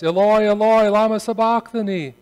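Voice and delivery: loud, high anguished cry to God. A narrator's reading. Jesus on the cross calls out to God, loud anguished cry. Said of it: "Jesus cried out in a loud voice".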